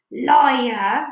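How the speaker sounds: loud, drawn-out jeer; scornful accusation. A narrator's reading. BFG answering the giant's false oath, scornful. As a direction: call him out loudly.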